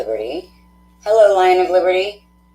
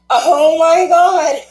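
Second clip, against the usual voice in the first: high, loud, slow shout of disbelief; overwhelmed cry; 12 semitones higher, 6 dB louder, much slower than usual. Real, not acted. Reader overwhelmed by a listener's story, high loud cry. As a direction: cry it out, nearly in tears.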